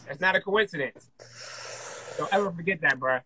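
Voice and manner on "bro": ordinary talk, nothing marked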